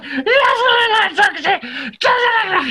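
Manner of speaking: high, shouting with frustration; getting really mad. Retold or imitated. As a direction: yell it, losing patience. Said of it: "he's getting really mad".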